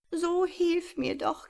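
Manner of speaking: high, slow, pleading owl voice; trapped owl begging the mouse for help, desperate; desperate plea. Acted. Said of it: "bat sie verzweifelt".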